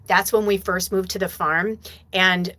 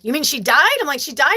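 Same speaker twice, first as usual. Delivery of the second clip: alarmed; 8 semitones higher, 5 dB louder than usual. Retold or imitated. Alarmed question to partner.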